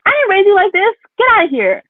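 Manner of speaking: mother kicking out her cilantro-hating kid, mock outrage; mock mom scolding. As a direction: throw the kid out in mock fury. Mom scolding imagined child.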